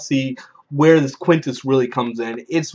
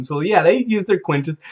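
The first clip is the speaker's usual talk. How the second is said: ordinary talk, nothing marked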